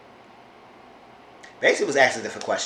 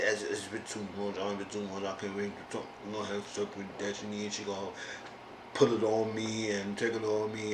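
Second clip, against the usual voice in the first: much lower and softer, mumbling through a heavy mouth; heavy-mouthed Sean; 3 semitones lower, 12 dB quieter than usual. Retold or imitated. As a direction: imitate Sean's thick, lazy mouth. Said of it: "he talk with his mouth like, like his heavy mouth".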